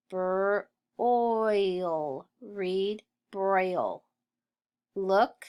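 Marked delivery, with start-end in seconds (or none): 0.1-2.3 "br" alone, then drawn-out "oil"; sounded out. Shown as a demonstration. Teacher sounding out broil for pupils, sounded out. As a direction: separate br from the rest.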